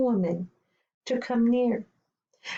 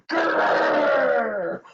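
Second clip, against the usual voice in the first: loud, higher growling "grrr"; bear growl; 5 semitones higher, 5 dB louder than usual. Acted. Bear jumps out to scare her.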